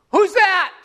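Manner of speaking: high, loud, startled question. Acted. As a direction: jump at an unseen voice. Hiker startled by a voice.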